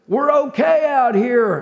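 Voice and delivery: high, slow call across water; man calling out. Retold or imitated. Preacher imitating the man calling from his boat, high drawn-out shout. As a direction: call it out high, stretching the words. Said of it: "I hear a man's voice".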